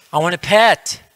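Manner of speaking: high, insistent mock kid voice; demanding child. Little one asking the yogi for a pet, high demand. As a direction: pipe up like a child who wants it now.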